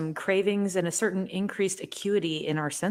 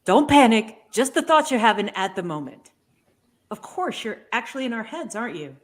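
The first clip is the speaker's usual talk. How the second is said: put-on childlike Ergo voice; 2 semitones higher, 7 dB louder than usual. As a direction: gush like an overexcited child. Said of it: "I'll give him a try".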